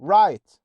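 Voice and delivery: "right" with no sound for GH; loud, clear model "right", GH dropped; silent GH. Shown as a demonstration. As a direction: say "right" like "rite". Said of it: "هيبقوا silent زي كلمة right".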